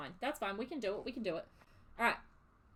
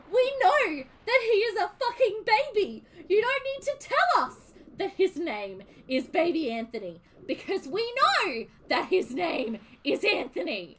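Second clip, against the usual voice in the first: higher and louder, fed-up complaint; exasperated rant; 7 semitones higher, 7 dB louder than usual. Retold or imitated. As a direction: rant at the screen in annoyance. Ranting at the show's naming.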